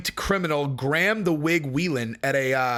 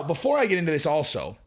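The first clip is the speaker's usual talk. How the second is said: ordinary talk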